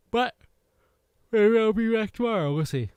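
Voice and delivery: high, loud teasing lilt; playful sing-song. Real, not acted. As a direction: tease it out playfully. Playful sign-off tease.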